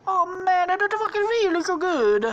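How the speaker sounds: loud, high put-on moan; high character voice. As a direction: complain in a very high cartoon voice. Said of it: "he's like".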